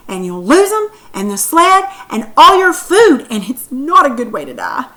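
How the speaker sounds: loud, lively reading in Sam's voice; read-aloud demo of Sam warning Steve. Shown as a demonstration. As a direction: perform the warning with urgency. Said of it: "Did you sound something like this?".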